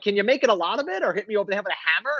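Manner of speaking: fast, desperate mock plea to the doctor; rushed plea. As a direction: rattle it off like a scared patient. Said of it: "I'm like".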